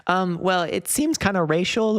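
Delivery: high, slow mock-mom voice; imitating mom. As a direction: squeak out mom's fumbling question. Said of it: "she's just like".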